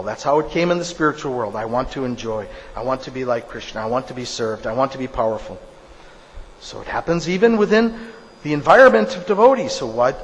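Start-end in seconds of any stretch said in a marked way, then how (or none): 1.5-5.6 low, soft recital of wants; inner voice of desire. Acted. Fallen soul lists its wishes to itself, low and quiet. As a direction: voice tempting thoughts, hushed and low.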